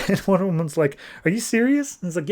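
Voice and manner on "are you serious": mock disbelief, pitched high; incredulous. Wonder Woman questions the gods' refusal, incredulous. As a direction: challenge it in higher-pitched disbelief.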